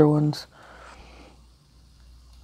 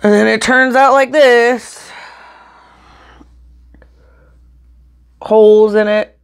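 louder and higher, slow exasperation; exasperated outburst; 4 semitones higher, 7 dB louder than usual. Real, not acted. Vlogger venting at camera about holey spring rolls. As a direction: let the frustration burst out over the ruined rolls.

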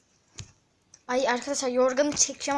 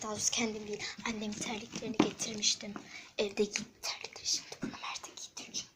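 whispering; 3 semitones lower, 8 dB quieter, faster than usual. Real, not acted. Sneaking near sleeping friend.

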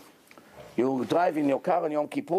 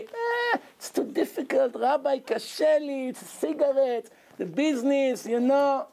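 higher-pitched, pleading excuse voice; mock the lame excuses, half-hearted; 7 semitones higher than usual.

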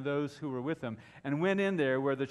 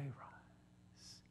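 whispered command; 19 dB quieter than usual. Retold or imitated. Preacher voicing a command to a dead boy, whispered. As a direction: drop to a very soft whisper.

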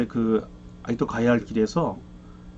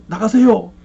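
retold begging, higher and louder, 제발 leaned on; pleading; 6 semitones higher, 6 dB louder than usual. Evangelist begging the demon politely, raised pleading voice. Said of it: "이렇게 말을 했답니다".

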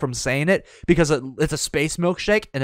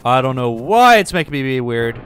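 usual speaking voice

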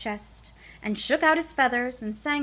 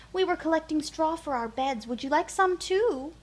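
higher, bright clucky hen; friendly hen voice; 7 semitones higher, 4 dB louder than usual. Acted. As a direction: offer it like a kindly hen. Hen offers straw.

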